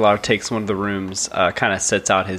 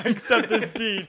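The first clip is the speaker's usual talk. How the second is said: giggling through the words; laughing; 11 semitones higher, 3 dB quieter, slower than usual. Real, not acted. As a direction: laugh it out, slowly. Group laughing at joke.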